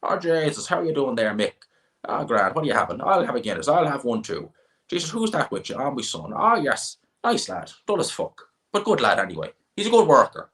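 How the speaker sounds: high, lilting Midlands Irish farmer chat; old Midlands farmer. Acted. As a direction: mimic old Irish Midlands farmers greeting each other. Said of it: "you get the old farmer set in their ways".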